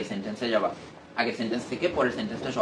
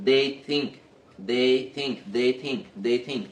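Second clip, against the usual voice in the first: "They think" said as one connected phrase, repeated evenly; steady rote repetition at reading speed; much slower than usual. Shown as a demonstration. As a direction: drill "They think" fluently, no gap between words. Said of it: "এরকম স্পিডে পড়তে পারতেছো".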